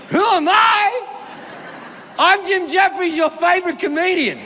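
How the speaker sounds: high-pitched, showy self-introduction; kids' party entertainer. Retold or imitated. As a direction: announce yourself like a children's party act. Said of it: "treat it like a kid's birthday party".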